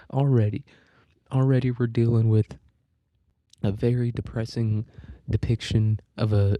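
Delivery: ordinary delivery, nothing marked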